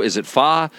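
"fa" with an open "ah"; pronunciation variant. Shown as a demonstration. Debating how to say the dish, "fa" with an open "ah". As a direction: say "fa" to rhyme with "spa". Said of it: "nobody can agree how to pronounce it".

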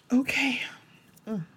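soft, breathy whisper to steady herself; tearful whisper. Real, not acted. Composing herself on camera, she whispers through tears. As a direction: whisper okay to pull yourself together. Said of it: "I didn't mean to cry about this".

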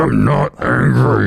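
slow, stretched-out denial; drawn-out denial. Pretend. Co-host insists he is not angry, drawn out slowly. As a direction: stretch out each word deliberately.

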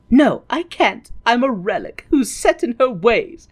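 mock weary refusal; McGonagall. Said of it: "McGonagall is like".

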